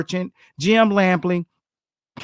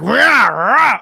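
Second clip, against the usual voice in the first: loud mock boxer grunts, rah rah; imitating grunts; 5 semitones higher, 6 dB louder than usual. Coach mimicking sparring fighters' grunts, loud mock grunting. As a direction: grunt it like fighters trading body shots. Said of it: "all you could hear the grunts".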